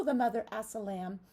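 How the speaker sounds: ordinary delivery, nothing marked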